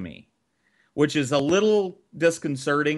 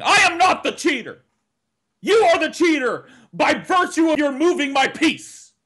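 high, loud indignant shout; retold high, loud yell at the taunting siblings; 13 semitones higher, 5 dB louder than usual. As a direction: yell your defence at the top of your lungs.

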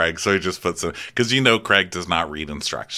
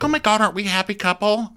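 high, fast, gushing; mock-sweet happy couple gush; 3 dB louder, faster than usual. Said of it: "They're like".

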